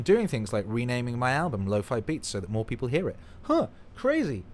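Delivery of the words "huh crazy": high, slow, drawn-out mock wonder; mock amazement. Musician mocking his own surprise at success, high and slow. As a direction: feign being amazed at yourself.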